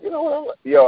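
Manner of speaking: blurting out that you're done with it; high-pitched fed-up quote; exasperated retold outburst. Retelling own exasperated exit.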